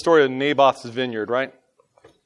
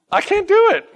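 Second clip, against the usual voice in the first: ordinary talk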